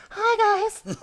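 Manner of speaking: high falsetto pop-star voice. Acted. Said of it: "he'll make you sound like [name]".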